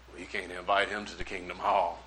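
low, hushed aside; low objection. Retold or imitated. Lady behind objecting to the invitation, hushed aside. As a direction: object softly and low, under the breath.

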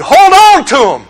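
high, insistent command; emphatic demand. Acted. Religion telling believers what to do, high and insistent.

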